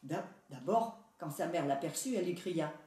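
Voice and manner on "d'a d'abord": ordinary delivery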